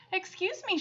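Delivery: put-on a sweet old woman at the door, bright; brightly. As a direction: chirp it in friendly. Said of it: "she said brightly".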